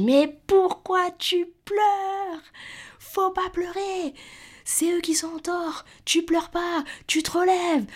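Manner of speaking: hammed-up scolding dad, very high drawn-out voice; father telling her off. Father scolding his crying daughter on the phone, high mock scolding. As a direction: mimic an angry parent in a high, stretched squeal. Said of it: "il commence à m'engueuler".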